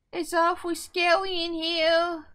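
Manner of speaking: high, loud, slow hearty voice; hello voice. Real, not acted. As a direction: put on a big cheery voice to stay brave. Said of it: "I'm doing the hello voice to try to get over it".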